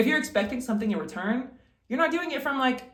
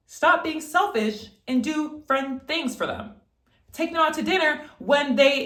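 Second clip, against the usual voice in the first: ordinary talk, nothing marked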